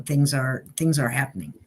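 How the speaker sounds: ordinary delivery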